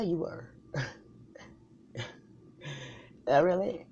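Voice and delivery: ordinary talk, nothing marked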